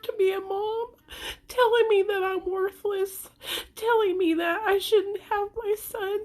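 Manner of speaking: real crying, voice pitched high. Real, not acted. Hurt mother recounting other moms' judgment, crying. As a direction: push each phrase out through tears. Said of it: "The reason why I'm crying".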